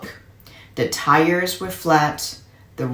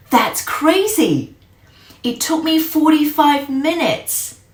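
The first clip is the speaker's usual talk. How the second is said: amazed; 11 semitones higher, 4 dB louder than usual. Acted. Boy amazed at uncle's fast time.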